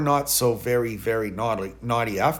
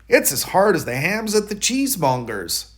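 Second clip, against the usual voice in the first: higher, louder indignant mouse voice; Hunca Munca complaining; 7 semitones higher, 5 dB louder than usual. Acted. Mouse wife grumbling about the ham, higher and louder. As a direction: squeak out the complaint, offended.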